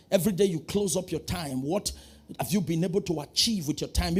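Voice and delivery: ordinary delivery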